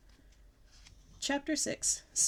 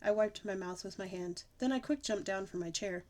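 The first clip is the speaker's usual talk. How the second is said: ordinary delivery, nothing marked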